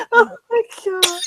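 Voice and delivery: high-pitched, laughter-choked exclamation; cracking up. Real, not acted. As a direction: squeeze it out while laughing hard. Still cracking up at candle joke.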